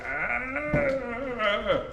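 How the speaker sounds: long high "ah", voice rising; mock dismayed cry. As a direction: let out a drawn-out, high wail as a mock reaction. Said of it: "French troops were like".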